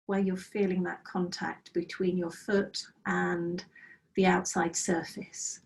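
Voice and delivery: calm, unhurried meditation guide voice; guided meditation. Acted. Teacher guiding students through a breathing practice, calm meditation voice. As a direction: lead the students gently into body awareness.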